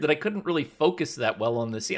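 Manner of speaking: ordinary talk, nothing marked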